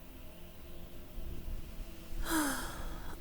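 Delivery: a long, quiet, breathy exhale; tender sigh gathering thoughts; long soft sigh. Real, not acted. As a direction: let the whole sigh drain out slowly. Long sigh before comforting words.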